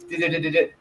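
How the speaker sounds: quiet clicking dial sounds; rotary dial imitation. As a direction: mimic the rotary dial turning. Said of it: "It was like".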